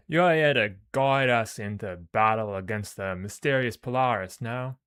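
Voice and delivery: loud, hammed-up Australian warrior; botched Aussie accent, projected loudly. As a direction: belt it out in a rough Australian accent. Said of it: "do the Aurorans as if they were Aussie".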